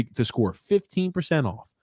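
ordinary delivery

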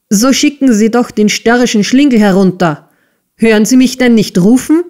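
cross schoolmaster barking orders; angry order. A narrator's reading. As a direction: scold impatiently, hurrying the final question. Enraged schoolmaster orders the assistant.

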